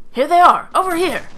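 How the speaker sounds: acted soldier's alarm call. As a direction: shout to alert the others. Soldier calling out to others.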